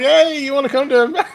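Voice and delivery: high, fast mock voice laughing through it; laughing mock imitation of the guy; imitating the guy. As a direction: squeal it out, hammed-up and giggling.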